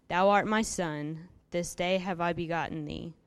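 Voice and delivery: ordinary talk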